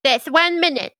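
spring the trap, pleased; imp voice. Acted.

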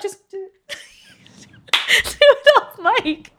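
high, very slow laughing urge. Real, not acted. Urging her friend to practise off mic, laughing. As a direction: drag it out through laughter.

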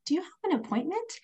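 high, cheery receptionist greeting; high-pitched, welcoming question; friendly, happy lady. Retold or imitated. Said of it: "this really friendly, happy lady".

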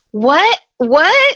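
rising, stunned What? What?; shocked disbelief. Retold or imitated. Retelling her shock when Fred turns out to be the janitor, stunned. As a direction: she can't believe what she just heard.

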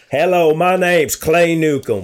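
slow, high and loud put-on Southern accent; Clay Newcomb accent. Teasing a fellow podcaster, slow mock Southern accent. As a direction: ham up a thick Southern introduction. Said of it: "He's got that accent".

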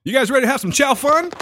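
high, eager emcee call; stand-up comic hyping crowd. Acted. Comic greeting diners at a Chinese restaurant, mock hype.

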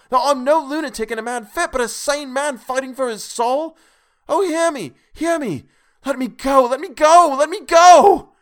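very high, very loud, rising to a shriek; frantic screaming plea. A narrator's reading. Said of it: "poured forth a torrent of entreaty".

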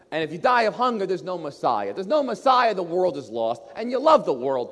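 acted urgent argument, rising in pitch and volume; pressing tempter. Satan argues that Jesus must eat, pressing. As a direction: press the case hard, point by point.

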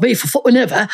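put-on Cockney chat; Cockney accent. Shown as a demonstration. Showing how they talked at university, put-on Cockney accent. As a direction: talk like a Cockney mate. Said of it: "a Cockney accent".

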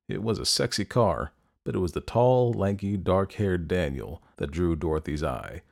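plain reading voice

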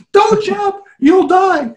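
high, loud playful warning cry; mock yell. Retelling the wife's plea, high loud mock yell. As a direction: squeal it like a panicked warning.

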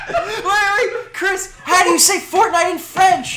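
high, loud laughing question; cracking up. Real, not acted. Cracking up over the Fortnite autocorrect with friends. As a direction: barely get the words out through laughter.

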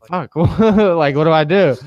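high, loud laughing panic; mock panic through a laugh. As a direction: blurt it out half laughing. Panicked inner quote, laughing.

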